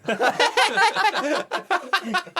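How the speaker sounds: shrieking burst of laughter; loud high laugh. Real, not acted. Laughing at Jordan quote.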